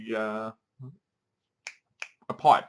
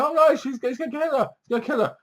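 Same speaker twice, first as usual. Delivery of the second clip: very high, panicky squeal; hammed-up high-pitched worry; mock panic; 12 semitones higher, faster than usual. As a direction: feign alarm as a gripped viewer. Acts out watching viewer alarmed.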